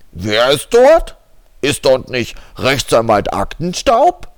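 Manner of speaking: over a landline phone. Acted.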